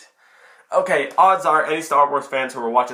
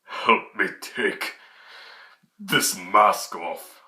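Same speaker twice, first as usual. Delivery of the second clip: lower, very slow, weak Vader voice; a hint of dying Vader's rasp; 6 semitones lower, much slower than usual. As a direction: plead slowly, fading. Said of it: "he's like".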